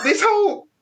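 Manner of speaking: ordinary talk, nothing marked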